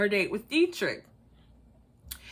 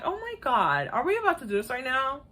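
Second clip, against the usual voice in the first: ordinary talk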